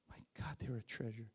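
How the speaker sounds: quick, hushed whisper of awe; overcome, voice dropping to a whisper; close to tears. Real, not acted. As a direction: breathe it out in tearful wonder. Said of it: "I'm gonna cry again".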